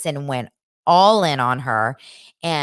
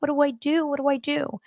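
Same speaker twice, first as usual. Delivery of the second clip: bawling mom; 10 semitones higher than usual. Retold or imitated. Mom once her son goes upstairs, imitated tearful helplessness. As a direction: imitate a mother falling apart. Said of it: "then she starts bawling".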